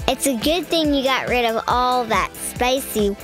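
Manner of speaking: plain reading voice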